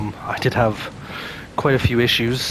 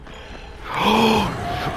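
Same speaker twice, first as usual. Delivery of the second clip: high, long gasp of fright; big startled gasp; 11 semitones higher than usual. Real, not acted. Gamer jumped by an unseen monster, gasping in fright. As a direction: gasp hard at a sudden scare.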